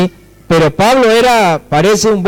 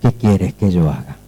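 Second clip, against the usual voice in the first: very low, soft, humble question; almost under the breath, in awe; trembling and fearful; 10 semitones lower, 5 dB quieter than usual. Acted. Paul answering his encounter with Jesus, trembling and fearful. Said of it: "Y temblando y temeroso le dijo".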